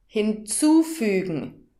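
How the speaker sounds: Hinzufügen said slowly, zu stressed; slow stress demo. Shown as a demonstration. Teacher repeating the word slowly for a learner, zu stressed. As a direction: draw out Hinzufügen and lean on the zu. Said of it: "Hörst du die Betonung?".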